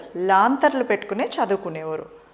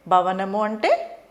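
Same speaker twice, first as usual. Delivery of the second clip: ordinary delivery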